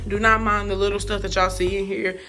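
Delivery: ordinary delivery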